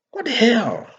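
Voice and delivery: high, incredulous exclamation; outraged disbelief. Real, not acted. Commentator scoffing at Ruto's call, incredulous. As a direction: jump up in disbelief. Outraged at Ruto backing reinstatement.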